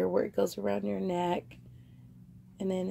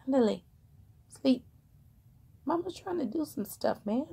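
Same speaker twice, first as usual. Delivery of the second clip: higher, coaxing pet talk; coaxing the dog; 4 semitones higher, faster than usual. Real, not acted. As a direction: gently shoo the dog off to sleep, voice raised.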